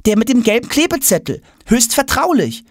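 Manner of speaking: put-on very high boy's voice; insistent boy. A narrator's reading. Tom reminds father of the stick, insistent. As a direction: prompt dad in a high boyish register.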